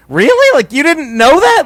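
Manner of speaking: high, loud retold disbelief; surprised. Defending his reaction to the kid's late discovery, surprised. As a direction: act out being astonished, voice shooting up. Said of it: "I was surprised that he just found out".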